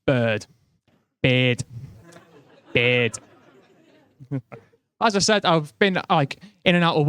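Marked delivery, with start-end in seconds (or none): 1.2-1.7 "Beard." as Scouse "bird", said /pɪd/; Scouse accent. Shown as a demonstration. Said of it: "my favourite word in a Scouse accent is bird". 2.7-3.2 "Beard." again, Scouse bird, /pɪd/; Scouse bird repeated; Scouse accent. Shown as a demonstration. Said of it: "my favourite word in a Scouse accent is bird".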